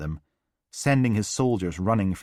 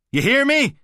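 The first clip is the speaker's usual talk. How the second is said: much higher, louder furious yell; furious shout; 10 dB louder than usual. A narrator's reading. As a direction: yell the threat at him. Father yelling threat.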